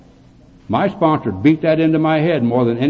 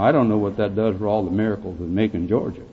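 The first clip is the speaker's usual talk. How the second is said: ordinary talk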